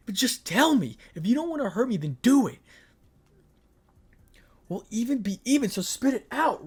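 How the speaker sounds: high, loud insistent woman's voice; a hurt girlfriend demands the truth; demanding girlfriend voice. Acted. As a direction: push him to spit it out. Ria demands he speak, voiced high.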